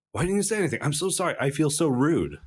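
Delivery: way up high, gushing sorry as the dog; high apologetic dog. Acted. Host voicing the dog apologizing to Momotaro, high and sheepish. Dog voiced in retold story.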